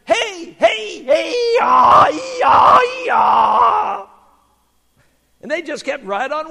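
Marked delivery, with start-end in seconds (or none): 0.0-4.1 high, loud donkey hee-haw; donkey bray. Retold or imitated. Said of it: "it only sounded like he was saying".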